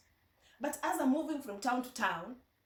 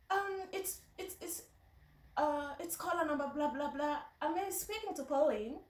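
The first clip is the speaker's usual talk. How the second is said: higher-pitched, hesitant caller's voice; phone-call voice; 4 semitones higher than usual. Retold or imitated. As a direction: stammer through a high-pitched phone introduction. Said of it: "on phone. I would like".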